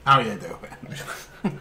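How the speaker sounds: slow, drawn out "how you doing"; Joey-style flirty line. Pretend. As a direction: lay on the flirty charm, slowly. Greeting an alien, joke.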